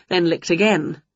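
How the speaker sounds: usual speaking voice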